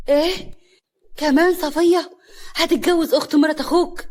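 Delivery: high, loud disbelieving questions; incredulous outburst; shocked outrage. A narrator's reading. Wife learns who the bride is.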